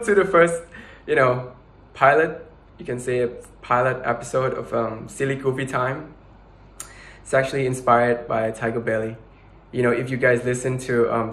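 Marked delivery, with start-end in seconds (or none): none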